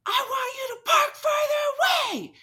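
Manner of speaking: very high, loud mock shriek; imitated furious scream. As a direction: mimic an enraged man screeching his demand. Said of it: "he was just screaming in my face".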